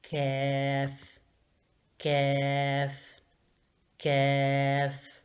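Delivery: کیف held very slowly, long vowel; drawn-out letter name. Shown as a demonstration. Teacher to pupils, sounding out کیف slowly. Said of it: "next one".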